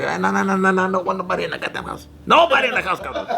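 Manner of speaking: rapid string of no's rising to an outburst; imitated dad refusal. Dad refusing to let friends in the house, imitated refusal.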